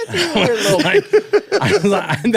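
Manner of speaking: breaking up while talking, high and slow; laughing. Real, not acted. Comedian to podcast hosts, laughing through retelling.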